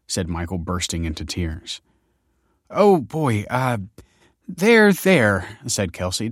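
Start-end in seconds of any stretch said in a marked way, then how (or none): 2.7-5.6 higher, louder awkward soothing; fumbling to comfort him. A narrator's reading. Young woman awkwardly comforting a crying man. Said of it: "awkwardly patting his shoulders".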